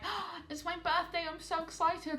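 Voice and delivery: high, gushing fake excitement; mock excited birthday girl. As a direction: gasp then gush like a giddy birthday girl. Mocking birthday-excited people.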